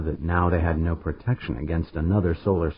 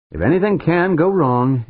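raised proclamation; 11 semitones higher, 7 dB louder than usual. A narrator's reading. Dan quoting Murphy's Law.

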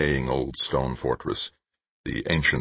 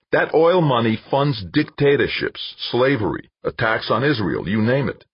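higher, louder eager pitch; 8 semitones higher, 4 dB louder than usual. A narrator's reading. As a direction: lean in and sell the idea. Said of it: "Hunching forward in his chair eagerly".

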